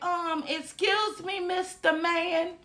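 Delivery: high, soft, slow faux politeness; mock polite. Calling to the worker with her food, high soft mock-polite. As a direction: sweetly hail the worker. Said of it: "I was like".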